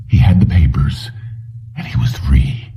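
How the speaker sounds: whispered, almost breathless relief; hushed whisper. A narrator's reading. Narrator hushing Jennings' escape, whispered relief. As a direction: let it out in a secret whisper.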